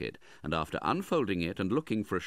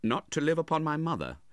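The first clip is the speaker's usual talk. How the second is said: higher, heartfelt retort; proud indignation; 9 semitones higher, 4 dB louder than usual. A narrator's reading. Nephew defends himself to his uncle, proudly indignant. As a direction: answer back with swelling pride. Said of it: "his heart swelling as he spoke".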